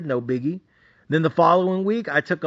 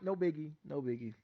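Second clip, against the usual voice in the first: very soft, low murmur; self-soothing; 4 semitones lower, 18 dB quieter than usual. Retold or imitated. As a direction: talk yourself down under your breath. Calming himself after the outburst.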